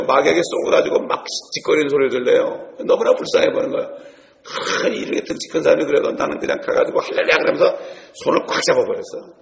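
ordinary talk, nothing marked

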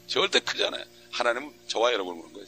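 ordinary delivery

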